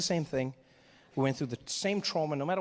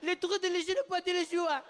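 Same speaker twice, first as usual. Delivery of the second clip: put-on much higher child's voice, whiny French; upset little boy; 13 semitones higher than usual. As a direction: play a tearful kid scolding his papa. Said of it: "he's like".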